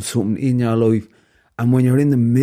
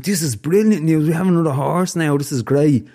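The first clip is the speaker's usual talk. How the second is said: bright high thrilled reaction; excited son; 6 semitones higher, 4 dB louder than usual. Retold or imitated. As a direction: gush like a delighted boy. Excited son in farmer story.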